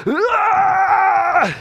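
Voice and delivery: long, high, loud scream; wild mock scream. Harold runs round the corner naked, imitated wild scream. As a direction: scream at full pitch, imitating a charging man. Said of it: "comes running around the corner like this".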